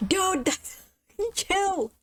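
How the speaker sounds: high laughing protest; laughing shocked. Real, not acted. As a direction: laugh it off, startled. Reacting to jump scare.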